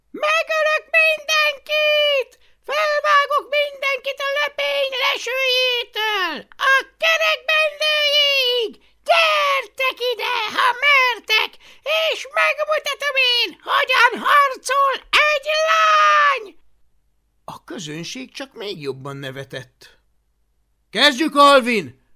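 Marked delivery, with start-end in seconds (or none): 0.1-16.6 high, loud furious war cry; screaming rage. A narrator's reading. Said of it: "torka szakadtából üvölteni kezdett". 20.9-22.1 loud, high call; shouted challenge. A narrator's reading. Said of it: "kiáltotta hablaty".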